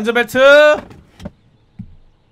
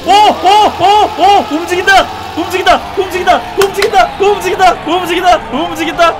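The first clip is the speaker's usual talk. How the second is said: higher, louder chant of joy; excited cheering; 6 semitones higher, 5 dB louder than usual. Real, not acted. Streamer cheering when his stuck car moves, excited. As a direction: cheer as the car finally rolls. Car finally starts moving in game.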